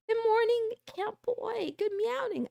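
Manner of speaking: high, sing-out cutesy greeting; playful high-pitched greeting voice; silly greeting voice. Pretend. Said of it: "why I'm talking like this".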